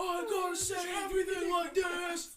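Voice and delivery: high, loud mock bellow; absurdly intense husband yelling; over-intense shouting. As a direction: shout it as if unable to talk normally. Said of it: "you don't need to say it like that".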